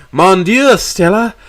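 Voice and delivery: worried French dad; French father voice. A narrator's reading.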